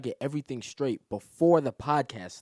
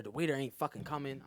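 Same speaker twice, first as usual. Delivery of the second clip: ordinary delivery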